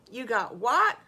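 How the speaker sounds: loud, rising disbelief; astonished question. Acted. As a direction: ask in amazement, punching the last word. Friends astonished at the news.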